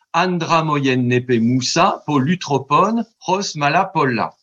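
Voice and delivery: ancient Greek read with a French accent. Shown as a demonstration. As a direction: recite Greek the French schoolroom way, measured. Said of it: "si je vous le dis à la française". (grec ancien prononcé à la française)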